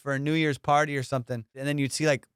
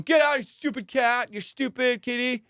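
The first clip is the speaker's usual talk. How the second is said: panicky hiker imitation; 9 semitones higher, 3 dB louder than usual. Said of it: "the whole video is him going".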